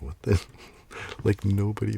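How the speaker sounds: sudden loud startled exclamation; mock shocked outburst. Reacting to the comic in a loud mock-shocked blurt. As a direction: react like you can't believe the page. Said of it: "I was like".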